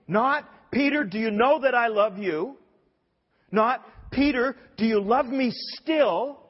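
high, insistent pulpit voice; impassioned preaching. Acted. Preacher putting Jesus's question to Peter, urgent and forceful.

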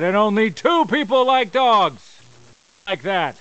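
wrestling promo. Acted. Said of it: "you just cut a promo".

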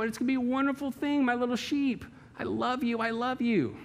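put-on high, cooing baby talk to the sheep; mock doting shepherd. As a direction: gush over the sheep like they're puppies. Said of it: "pet the sheep and say".

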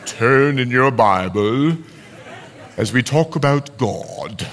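mock-majestic pulpit announcement; James Earl Jones. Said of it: "spoke like James Earl Jones".

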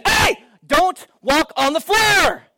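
put-on high, slow yell at the kids; mock parent shout. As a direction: bark it like a fussy parent.

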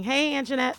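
ordinary talk

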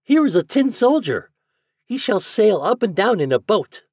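put-on high loud boy voice; eager kid showing off a find; excited boy. A narrator's reading.

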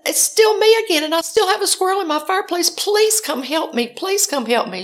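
high, urgent plea for help; pleading. Retold or imitated. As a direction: beg desperately, loud at first, pitch well up. Pleading with dispatcher on phone.

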